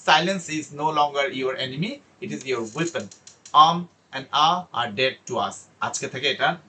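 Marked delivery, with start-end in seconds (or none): 0.1-5.6 slow, emphatic motivational delivery; rousing closing speech. Real, not acted. Teacher sends students off with their new tools, slow and emphatic.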